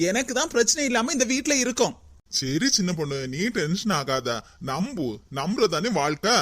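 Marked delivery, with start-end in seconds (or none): 2.4-6.3 soothing. Acted. Calming the annoyed sister-in-law.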